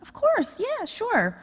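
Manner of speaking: high, bright eager agreement; eager yes. Retold or imitated. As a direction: squeak out a happy yes. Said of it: "So I was like".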